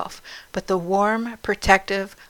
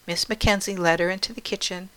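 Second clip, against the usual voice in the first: usual speaking voice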